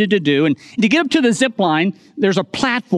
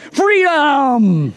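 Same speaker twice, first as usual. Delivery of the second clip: mock battle cry; 6 semitones higher, 3 dB louder than usual. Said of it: "We quoted scenes from Braveheart".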